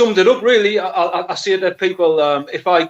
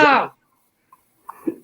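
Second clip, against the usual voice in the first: put-on Geordie accent; 5 semitones higher than usual. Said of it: "is that Geordie accent".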